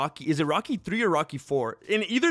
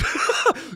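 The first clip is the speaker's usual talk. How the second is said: laughing. Real, not acted. Laughing at his Bible workout idea.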